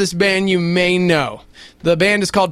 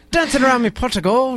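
put-on lilting Irish leprechaun accent, higher; 5 semitones higher than usual. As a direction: play a cheeky Irish leprechaun. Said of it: "Say that in your Irish".

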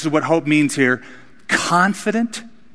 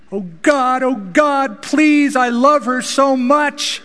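desperate praying father; 11 semitones higher, slower than usual. Retold or imitated. Luther pleads with God for his sick daughter, high desperate plea. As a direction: beg God for your dying daughter's life. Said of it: "prayed like any dad would".

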